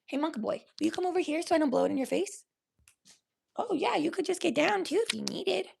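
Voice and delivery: soft, high coaxing voice; gentle pet talk. Real, not acted. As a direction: coax your pet softly, up high. Asking pet to move over.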